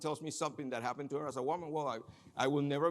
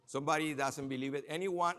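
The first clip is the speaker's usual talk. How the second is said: ordinary talk, nothing marked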